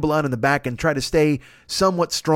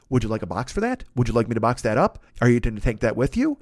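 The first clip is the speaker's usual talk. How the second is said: polite waiter line; 8 semitones lower than usual. Retold or imitated. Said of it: "your next line is".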